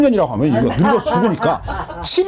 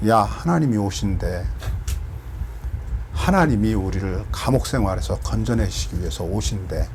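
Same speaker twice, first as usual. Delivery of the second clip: low, soft and lifeless, the wrong way to announce; mock flat whisper-soft telling; 13 semitones lower, 6 dB quieter than usual. Shown as a demonstration. Preacher showing how not to tell the good news, low and soft. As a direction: mumble the good news lower and softer with no joy. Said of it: "어떻게 전해야 될까?".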